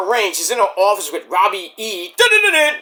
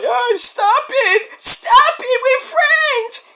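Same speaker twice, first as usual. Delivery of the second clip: high, slow wailing cry; whiny plea; much slower than usual. Retold or imitated. Jasmine pleading with her arguing friends, high wail. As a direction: beg them to stop, squealing. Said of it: "poor Jasmine Nix is like".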